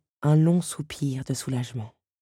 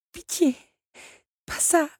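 pleading in fear; 12 semitones higher than usual. Acted. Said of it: "le visage déformé par la peur".

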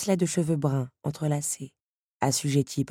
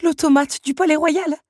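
high, loud stunned recognition; shocked; 12 semitones higher, 9 dB louder than usual. A narrator's reading. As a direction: exclaim in shock, pitched high. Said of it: "sous le choc". (stupeur, voix aiguë et forte)

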